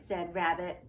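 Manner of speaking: ordinary delivery, nothing marked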